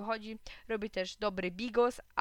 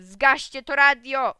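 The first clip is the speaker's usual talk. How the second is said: slow, very loud, higher-pitched order; angry shout; 5 semitones higher, 14 dB louder, slower than usual. A narrator's reading. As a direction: yell it as a fed-up dad. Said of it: "krzyknął i poszedł". (głośny, gniewny krzyk taty)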